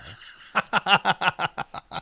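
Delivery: loud burst of laughter; laughing hard at the story's punchline; real laugh. Real, not acted. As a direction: crack up at the punchline. Laughing at his own story.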